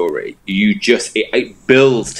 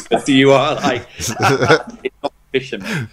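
breaking into laughter, pitch lifted; real laugh bubbling through the words; 5 semitones higher than usual. Real, not acted. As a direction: try to talk through the giggles. Cracking up at own innuendo.

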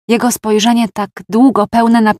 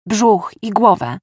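ordinary delivery, nothing marked

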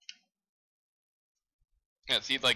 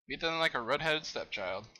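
ordinary talk

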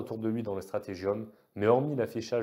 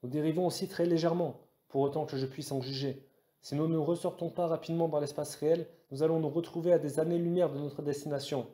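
high-pitched worried report; anxious navigator; 6 semitones higher than usual. A narrator's reading. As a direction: warn the captain, pitched high. (navigateur inquiet, voix aiguë)

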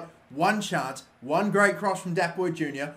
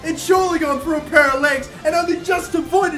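high, loud screaming celebration with laughs; ecstatic shout; 9 semitones higher, 5 dB louder than usual. Real, not acted. Gamer screaming with joy as his side scores in extra time.

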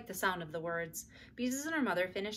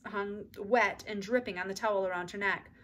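ordinary delivery, nothing marked

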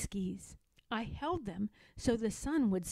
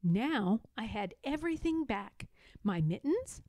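ordinary delivery

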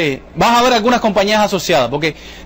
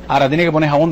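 normal talking voice

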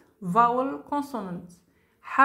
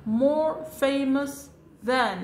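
each word stretched for the class; slow teacher demo; slow model reading; 2 semitones higher, much slower than usual. Shown as a demonstration. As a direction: lay it out slowly for learners. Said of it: "لكن قبلها نضع more".

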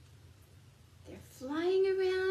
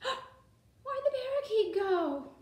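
high, slow gasping question; mock surprise; 6 semitones higher, slower than usual. Teacher to class finding cage empty, hammed-up surprise. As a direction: act shocked for the kids. Missing birds, mock alarm.

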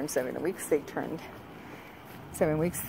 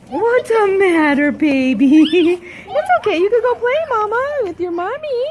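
high, loud cooing; 8 semitones higher, 6 dB louder than usual. Real, not acted. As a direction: soothe the puppy with loud high coos. Cooing at puppy.

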